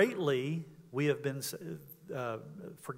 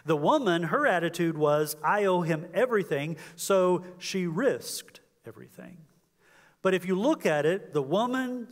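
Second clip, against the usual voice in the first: raised, louder, slowing preacher's climax; fervent preaching; 4 semitones higher, 7 dB louder than usual. Acted. Preacher pressing the point home.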